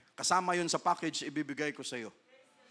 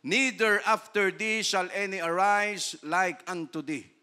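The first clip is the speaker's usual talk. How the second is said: slow solemn verse; slow King James verse; slow scripture reading; 5 dB louder, slower than usual. Acted. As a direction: draw out each word. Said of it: "quotes scripture".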